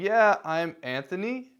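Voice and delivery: rising question tune on "Yeah, I'm Anthony", slow and unsure; upward inflection. Shown as a demonstration. Sales caller showing his nervous intro, unsure rising tune. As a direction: let "Anthony" lift up like a question. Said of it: "end it with an upwards inflection".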